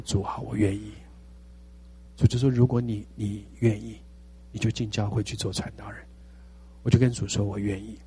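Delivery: quiet surrender. Retold or imitated. Pastor telling God he is willing, softly. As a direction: breathe it out like a broken confession. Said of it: "surrender to God".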